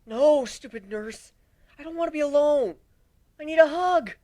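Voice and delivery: petulant, needy whining; whiny protest. Acted. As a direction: whine like a sulky child. Whiny protest after nurse.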